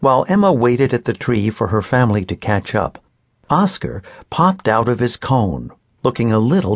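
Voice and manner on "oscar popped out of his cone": plain reading voice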